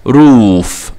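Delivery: روف with a long oo; long double O. Shown as a demonstration. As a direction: stretch the vowel in روف. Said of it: "لونج O". (روف بمد الواو)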